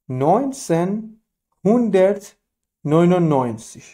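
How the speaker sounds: نوینسن هوندرت نوینوناینزیگ said slowly, one word at a time with pauses between; slow and broken up. Shown as a demonstration. Teacher repeating the year slowly for learners. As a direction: spell the year out word by word for students.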